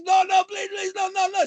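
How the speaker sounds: high, mock terrified begging; pleading. Clerk begging the robber not to burn the store, high mock terror. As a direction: squeal please no like a panicked clerk.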